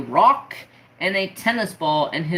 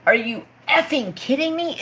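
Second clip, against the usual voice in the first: much higher, incredulous retort; indignant disbelief; 7 semitones higher than usual. Retold or imitated. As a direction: throw the question back at dad in outrage. Outraged reply to dad's question.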